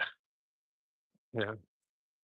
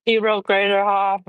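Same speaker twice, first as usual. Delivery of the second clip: mocking laughing disbelief; 8 semitones higher than usual. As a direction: draw it out as if the table is cracking up. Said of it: "everybody in the table starts laughing".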